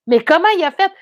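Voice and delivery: baffled at how the car got there. Retold or imitated. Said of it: "Là, je fais".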